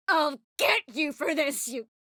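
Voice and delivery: high, strained shout of revenge; angry threat. Acted. Fighter threatens an enemy with revenge, angry high shout. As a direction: yell the threat at them.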